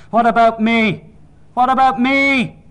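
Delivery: put-on high, loud, drawn-out baby's howl; screaming infant demanding attention; full-blast wail. A narrator's reading. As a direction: wail it out slowly at full volume. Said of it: "I was crying now, full blast. Everything was in my wail".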